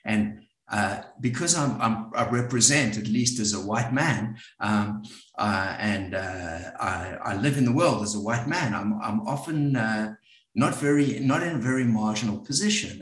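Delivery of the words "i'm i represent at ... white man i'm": normal talking voice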